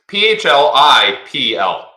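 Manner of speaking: slow, loud letter-by-letter joke spelling; mock misspelling. Shown as a demonstration. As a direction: spell it out wrong on purpose, slowly. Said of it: "me spelling Phil".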